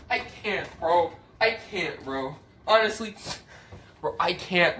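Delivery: usual speaking voice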